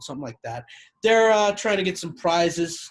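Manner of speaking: ordinary talk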